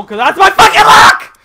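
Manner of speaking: builds to a furious scream; raging gamer. Real, not acted. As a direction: blow up at your bad luck. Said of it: "I've never heard you scream like that before".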